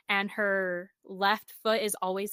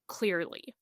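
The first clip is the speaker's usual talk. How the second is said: ordinary delivery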